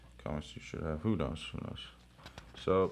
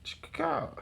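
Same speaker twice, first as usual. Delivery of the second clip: ordinary talk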